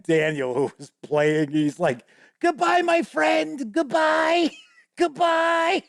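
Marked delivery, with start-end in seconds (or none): 2.4-5.8 much higher, slow goblin voice; a drawn-out fond goodbye from a goblin; goblin farewell. Retold or imitated. Goblin bidding farewell to the piano player, high and slow. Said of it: "the goblin is looking at".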